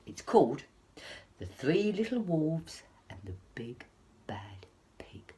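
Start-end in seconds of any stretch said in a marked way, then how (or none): 1.4-5.3 whispered title, big reveal at the end. Acted. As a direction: hush it like a secret. Title told as a secret.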